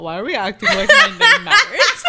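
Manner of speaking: high laughing question. Real, not acted. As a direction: laugh through the words, high and loud.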